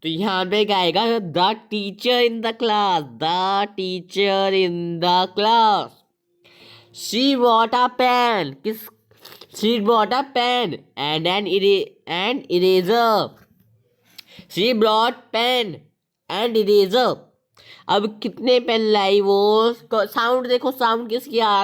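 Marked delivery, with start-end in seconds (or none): 1.0-5.9 very slow, each word spaced out; slow dictation. Shown as a demonstration. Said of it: "teacher dictates the sentence". 7.0-17.2 very slow, words stretched and spaced; slow dictation. Shown as a demonstration. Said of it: "teacher dictates the sentence".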